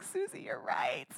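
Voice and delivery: a real whisper, breath only. Retold or imitated. Preacher humbly admitting Susie was right, in a whisper. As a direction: hush it, humbled and caught out. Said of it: "I'm like".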